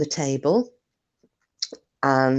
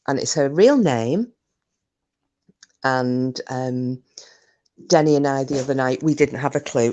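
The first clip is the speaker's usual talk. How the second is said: usual speaking voice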